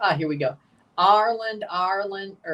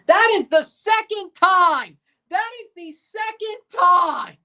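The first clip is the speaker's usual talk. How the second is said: outraged shout; 8 semitones higher, 4 dB louder than usual. Real, not acted. Fan fuming at the keeper's second blunder, outraged shout. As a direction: hammer home the repeat disbelief. Outraged at repeated goalie error.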